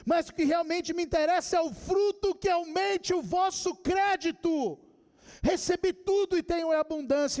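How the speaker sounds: emphatic pulpit reading in a high pitch; preached scripture reading. Acted. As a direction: proclaim the verses with conviction. Proclaims a Bible passage.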